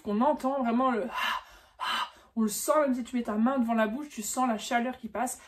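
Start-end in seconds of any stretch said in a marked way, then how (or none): 1.0-2.1 the German h breathed out hard, ha; voiced aspirated h; breathy h. Shown as a demonstration. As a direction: blow out a strong h sound on ha. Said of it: "c'est un H qui souffle".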